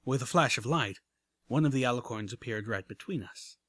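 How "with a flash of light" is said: ordinary delivery, nothing marked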